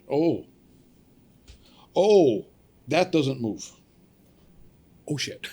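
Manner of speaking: loud, high startled oh; alarmed. Retold or imitated. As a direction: realize something is very wrong. Discovers arm won't move.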